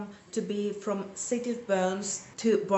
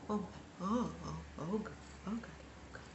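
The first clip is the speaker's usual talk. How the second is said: fading to a breathless whisper; panicked whisper; 4 semitones lower, 14 dB quieter, faster than usual. Retold or imitated. Reader panics that the series is over, whispering. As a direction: murmur oh God as the panic sinks in.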